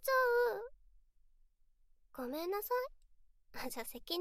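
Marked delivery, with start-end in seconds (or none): 0.0-0.7 mock crying. Fake sulking after being called cruel.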